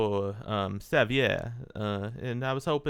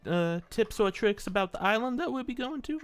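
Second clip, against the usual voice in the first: high character voice; 10 semitones higher, 3 dB louder than usual. Acted. Player questions barkeep in voice.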